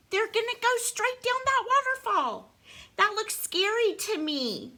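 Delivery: high-pitched storybook alarm; mock fright. Reader warns child of waterfall, mock fright. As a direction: play up the danger in a high voice.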